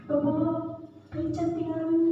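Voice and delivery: soft, high little plea; crying juniors' plea. Retold or imitated. Crying juniors meekly asking to borrow money, soft and high. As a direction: ask meekly through tears.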